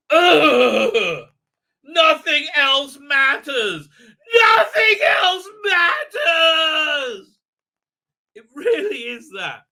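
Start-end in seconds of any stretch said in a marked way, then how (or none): 0.1-1.3 loud, hammed-up groaning cry; mock anguished wail. Mock tantrum of imitated woman. 1.8-3.9 high, loud, slow fake crying; mock tearful wail. Mock tantrum of imitated woman. 4.3-7.4 very high, drawn-out, loud fake sobbing scream; mock screaming tantrum. Tantrum repeated at a higher pitch.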